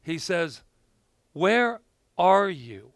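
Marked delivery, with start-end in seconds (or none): 1.3-2.9 slow, drawn-out "Where are you?"; a teacher's slow probing question; slow searching question. Acted. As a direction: ask it slowly, leaning on "Where". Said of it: "So this is what he says".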